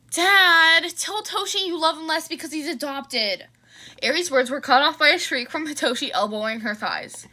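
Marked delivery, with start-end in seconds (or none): 0.1-3.5 bratty tattle. Acted. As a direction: needle her brother through Dad. Teen tattling to dad in car.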